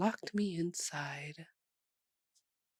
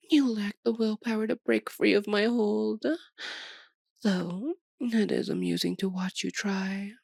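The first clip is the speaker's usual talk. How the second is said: higher, louder, mock-pitying vampire; mocking taunt; 3 semitones higher, 4 dB louder than usual. Vampire taunting the knight who resists her, mocking. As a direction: toy with your helpless prey.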